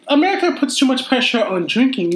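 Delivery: ordinary delivery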